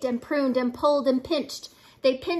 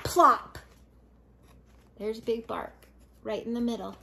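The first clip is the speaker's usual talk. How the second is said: plain reading voice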